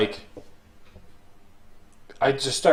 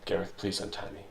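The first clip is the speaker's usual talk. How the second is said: soft, tired request to Gareth; quiet plea in character; 4 semitones lower, 15 dB quieter than usual. Acted. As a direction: ask low and weary, almost under the breath. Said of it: "I'll be like".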